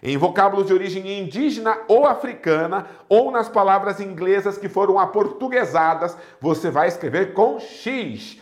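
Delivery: ordinary talk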